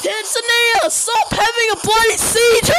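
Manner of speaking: high, loud demands to stop; exasperated yelling. Real, not acted. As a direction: shout at the screen in alarm.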